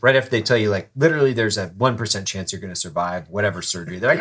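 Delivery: normal talking voice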